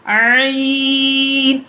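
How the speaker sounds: आइन stretched long from the middle of the throat; long ain. Shown as a demonstration. Teacher showing how to draw आइन out long. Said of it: "लंबा खींचना है".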